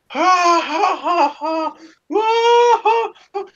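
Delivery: high cackling mock laugh; mom's laugh. Mom jumping out after scaring her son, laughing. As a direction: cackle like you just pulled off the prank. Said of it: "she starts laughing".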